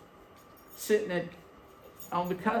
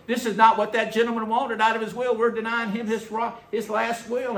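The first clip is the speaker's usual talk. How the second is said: high, loud indignant protest; preacher retelling his own angry protest; retold heated protest; 9 semitones higher, 5 dB louder than usual. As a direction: argue it hotly, pitched up. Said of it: "they just smiled and said".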